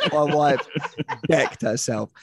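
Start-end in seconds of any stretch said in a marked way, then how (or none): none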